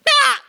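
put-on high short yelp of a kid leaping; mock scream. Pastor voices a kid jumping off the diving board, squealing. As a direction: let out a quick high jump squeal.

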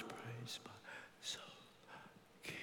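soundless whispered words, breath only; barely mouthed. Retold or imitated. Preacher overcome at seeing woman, mouthing hymn in whisper. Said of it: "I just mouthed the words".

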